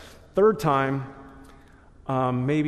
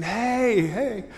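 higher, louder call across a parking lot; 8 semitones higher, 5 dB louder than usual. Retold or imitated. Pastor calls after the man leaving church. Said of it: "I hear this voice saying".

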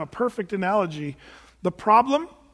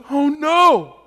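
much higher, loud, half-laughing fake panic; mock alarmed reaction; 8 semitones higher, 5 dB louder than usual. Mocking what nobody tells an expectant mom, in loud laughing alarm. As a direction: ham up a horrified friend hearing pregnancy news. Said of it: "nobody goes".

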